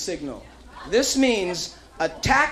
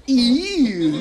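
"Ew" pushed out louder with a sneer; mainland disgust; 2 semitones higher, 3 dB louder than usual. Shown as a demonstration. Escort shows tourists the mainland reaction of disgust. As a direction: give a big disgusted "Ew". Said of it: "you gotta go".

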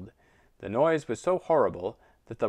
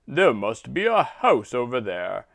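lightly voiced rooster; 6 dB louder than usual.

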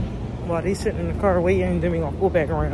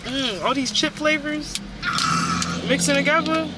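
disgusted; 9 semitones higher than usual. Real, not acted. Complaining about chip smell.

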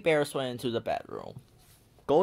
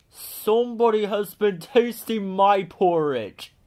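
booming, slow and higher, a big bear's grumble; great big bear voice; 9 semitones higher, 8 dB louder than usual. A narrator's reading. Papa bear finds his porridge touched, booming. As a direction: play the great big bear, loud and weighty. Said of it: "said the great big bear in his great big voice".

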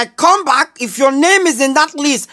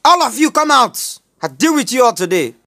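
usual speaking voice

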